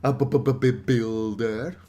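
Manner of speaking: slow stammered b-b-b-builder; stuttering. Acted. Stunned villager stammering about the builder. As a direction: stammer it out slowly.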